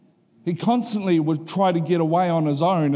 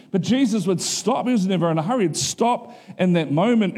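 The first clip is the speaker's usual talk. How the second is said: ordinary talk, nothing marked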